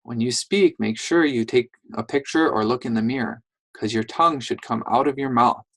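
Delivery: ordinary talk, nothing marked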